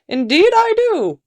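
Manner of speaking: very high character voice; second man. A narrator's reading. Second man offering a skull to the lady, high-pitched voice. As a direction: answer high, as the eager second trader. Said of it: "said the second man".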